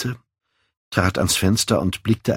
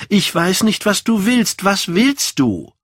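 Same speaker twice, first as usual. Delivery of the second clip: high, agitated demand for an answer; exasperated exclamation; 13 semitones higher, 5 dB louder than usual. A narrator's reading. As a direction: cry it out high, drawing out the last question. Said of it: "rief er aus und drehte sich um".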